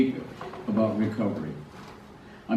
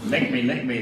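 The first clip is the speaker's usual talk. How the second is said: Exorcist possession impression. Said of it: "my Linda Blair and the Exorcist thing".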